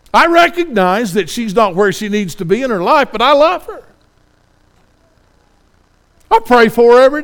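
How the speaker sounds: high, loud pleading sermon voice; impassioned preaching. Acted. Pastor pleads with the congregation, impassioned preaching. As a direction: preach it out with rising fervor to the congregation. Preacher builds to full fervor.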